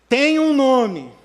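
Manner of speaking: high, loud, stretched-out preacher's cry; loud proclamation. Real, not acted. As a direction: proclaim it slowly at full force. Same phrase proclaimed at full force.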